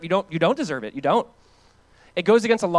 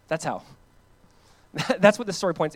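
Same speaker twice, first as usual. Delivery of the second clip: usual speaking voice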